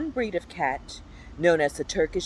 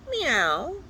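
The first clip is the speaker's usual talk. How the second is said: higher, asking cat meow; a simple hungry cat asking; plain meow; 8 semitones higher than usual. Acted. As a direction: meow with a small upturn. Cat sound list.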